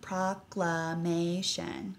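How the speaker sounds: "Proclamation" said very slowly, each syllable apart; syllables spelled out slowly. Shown as a demonstration. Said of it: "How many syllables are in that?".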